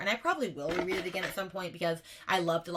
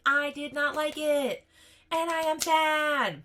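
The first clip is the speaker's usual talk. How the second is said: higher, slower and louder, hammed-up sadness; mock sad whine; 8 semitones higher, 5 dB louder, much slower than usual. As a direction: wail it like a playful pouting child. Mock grief over disliked book.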